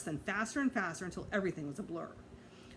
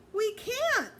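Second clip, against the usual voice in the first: high, louder gleeful yell; 14 semitones higher, 5 dB louder than usual. A narrator's reading. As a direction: shout it while spinning. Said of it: "Fudge yelled".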